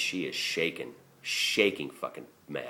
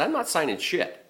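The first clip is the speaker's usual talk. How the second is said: pitched up, firm refusal; defiant refusal; 13 semitones higher than usual. Retold or imitated. To his boss, refusing to sign: defiant refusal.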